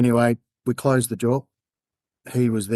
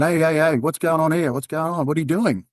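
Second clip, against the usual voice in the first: higher, urgent hey hey hey; alarmed; 6 semitones higher than usual. Retold or imitated. Cop hears his friend is about to jump, alarmed. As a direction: snap into alarm at bad news.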